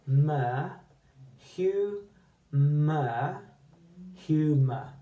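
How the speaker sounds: slow syllable-by-syllable humour; syllables split out. Shown as a demonstration. Teacher to pupils, sounding out each syllable. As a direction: break humour into its beats. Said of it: "and so on".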